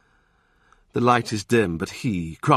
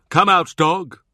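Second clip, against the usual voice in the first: louder, higher call; 8 semitones higher, 6 dB louder than usual. Acted. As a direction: summon him with amusement. Wolsey calls Cromwell.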